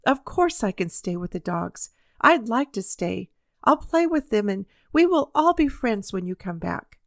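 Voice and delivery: usual speaking voice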